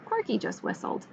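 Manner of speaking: usual speaking voice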